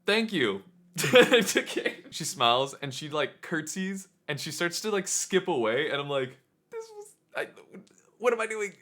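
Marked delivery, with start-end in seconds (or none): 0.1-1.9 giddy laugh. Retold or imitated. Flustered thanks, then laughing. 6.7-8.7 flustered panic. Retold or imitated. Panicking at his own flop.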